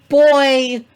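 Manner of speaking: loud call across the house. Real, not acted. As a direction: shout his name out loud. Calling out to her husband.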